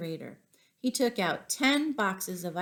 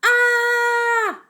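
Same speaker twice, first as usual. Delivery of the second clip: high, loud shriek of fright; father startled by his son, shrieking; startled yell; 11 dB louder than usual. Acted. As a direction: scream like you've been ambushed. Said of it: "His father turned around and yelled".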